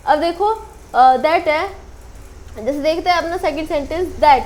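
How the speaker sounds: ordinary talk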